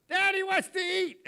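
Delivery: put-on high, loud little girl announcing; little daughter's call. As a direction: shout it like a small girl across the house. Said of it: "Brighton, she'll say".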